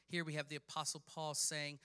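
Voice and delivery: ordinary talk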